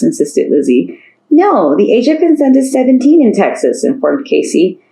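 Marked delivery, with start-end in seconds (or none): 1.3-3.8 put-on eager teen girl voice. Casey voiced, eager and high.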